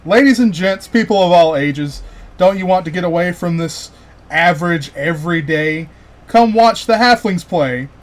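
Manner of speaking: loud crowd caller; calling out. Acted. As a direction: project to a crowd like a festival barker. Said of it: "he calls out".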